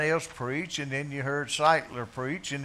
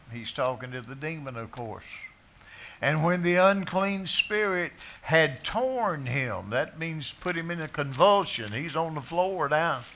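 ordinary delivery

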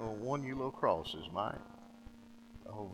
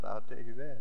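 normal talking voice

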